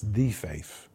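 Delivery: THE said slowly and weightily before "faith"; stress on THE. Shown as a demonstration. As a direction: lean on "the" as the one and only. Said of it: "It's a definite article".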